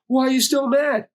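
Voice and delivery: whiny high-pitched mock question; mock complaint. Son mimics nagging his dad, mock complaint. Mocking question he wouldn't ask.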